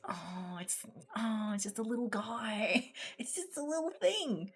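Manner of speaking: very high, tiny cooing voice over a little figure; cutesy cooing voice. Retold or imitated. Friend cooing over a tiny figurine, squeaky cute voice. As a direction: coo at it like a baby.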